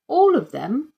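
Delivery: both surprised; surprised chorus. A narrator's reading. Dad and inspector in surprise. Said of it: "chorused Miss Rice and Dad".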